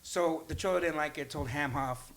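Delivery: usual speaking voice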